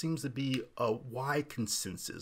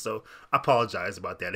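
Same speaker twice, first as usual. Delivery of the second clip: ordinary talk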